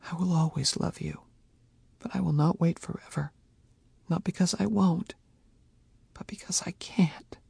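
soft, firm, resigned statement; quiet resignation. Acted. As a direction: state it, no longer pleading. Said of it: "more statement than it was pleading".

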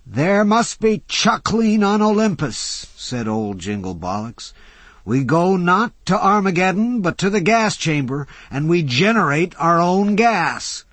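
put-on high, loud grand old man; a lightly theatrical old philosopher lecturing; old orator. A narrator's reading. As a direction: hold forth with gleeful doom. Old Jay's voice.

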